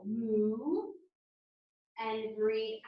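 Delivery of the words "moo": a lightly voiced moo like a cow. Shown as a demonstration. Yoga teacher showing children the cow sound, mild moo. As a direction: moo for the kids to copy. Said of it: "make the sound of a cow".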